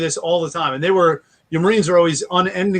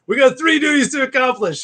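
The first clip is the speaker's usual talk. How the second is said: Marine leader voice; 9 semitones higher than usual. Retold or imitated. Dad ordering his kids around, drill leader barking commands. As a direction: bark it out like you're briefing troops. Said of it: "I have a tendency to blade hand".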